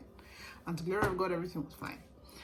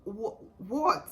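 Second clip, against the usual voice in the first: confused husband; 4 semitones higher, 7 dB louder, much slower than usual. Retold or imitated. Husband confused by her outburst.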